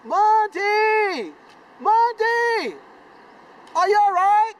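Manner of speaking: high, loud worried yells; yelling. Retold or imitated. Boys below shouting up to check their friend is okay, high worried yells. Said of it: "I hear the boys yelling".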